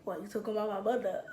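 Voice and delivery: low, soft and quick; quiet retold question. Boyfriend answering his partner late, quiet and low. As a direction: imitate a man asking in a low voice.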